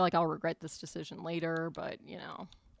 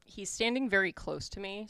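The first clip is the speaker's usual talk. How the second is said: ordinary talk, nothing marked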